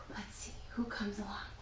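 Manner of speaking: breathy whisper, voice dropped low and soft; whispered aside. Real, not acted. Whispering to the children mid-story.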